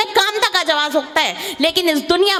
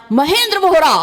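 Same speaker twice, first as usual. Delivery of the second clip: louder call across the hills; 3 semitones higher, 4 dB louder than usual. Retold or imitated. Poet calls Vohra's name into the hills, loud. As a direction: shout the full name out. Calling out to the hill.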